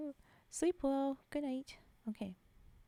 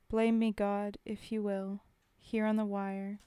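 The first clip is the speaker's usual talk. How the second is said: poem reading; 6 dB louder than usual. Acted. Streamer reading a war poem to chat, solemn. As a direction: read it like a soldier's last words.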